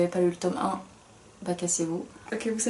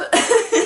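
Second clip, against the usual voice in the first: petit rire aigu et fort; 13 semitones higher, 8 dB louder than usual. Real, not acted. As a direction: rire haut perché. Rire après Bref. (rire bref et aigu)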